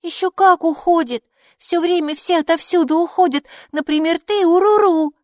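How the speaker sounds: put-on high, loud, contrary little girl; arguing child. A narrator's reading. Майки disputes that things stay put, high arguing child voice. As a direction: argue back in a high child's voice. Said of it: "заспорила Майки".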